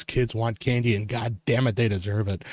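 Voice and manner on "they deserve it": ordinary talk, nothing marked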